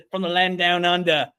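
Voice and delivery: playful Australian impression; Australian accent. Shown as a demonstration. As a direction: announce it in a mock Aussie accent. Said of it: "I might be a little Australian".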